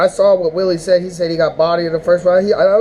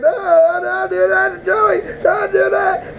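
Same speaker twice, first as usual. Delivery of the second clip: high, sobbing plea; hammed-up crying, much higher; mock wailing; 11 semitones higher, much slower than usual. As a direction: beg through fake tears. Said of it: "was like".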